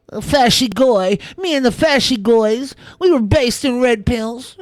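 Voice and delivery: put-on, drawn-out ridicule; mocking quote. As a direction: draw the slang out in mockery. Mocking online far-right slang, then dismissing it.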